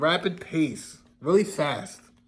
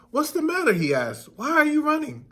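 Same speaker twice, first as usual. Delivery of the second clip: much higher rooster voice; a rooster calling down from a branch; curious rooster; 8 semitones higher than usual. A narrator's reading. Rooster on a branch asks the friends, high and curious.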